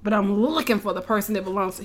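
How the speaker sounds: ordinary talk, nothing marked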